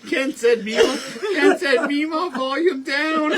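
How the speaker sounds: very high, loud holler; shouted relayed message. Real, not acted. Passing on Ken's complaint to Meemaw, high shout. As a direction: yell it over the noise, breaking into laughter.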